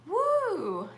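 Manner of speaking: high, drawn-out "woo"; impressed whoo. Real, not acted. Host reacts to a viewer's heavy teaching load, high whoo. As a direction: let out a long high whoo.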